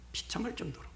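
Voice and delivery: whispered, high and breathless; hushed whisper. Real, not acted. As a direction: drop to a whisper for pity. Aside on the captive king.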